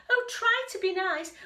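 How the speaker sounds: high loud plea; pleading. Acted. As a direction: beg to be spared. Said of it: "he whimpered".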